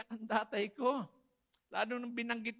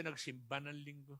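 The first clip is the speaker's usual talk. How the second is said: ordinary talk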